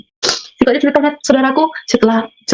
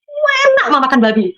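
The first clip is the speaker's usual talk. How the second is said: excited son; 5 semitones higher than usual. Retold or imitated. Son delighted by pork.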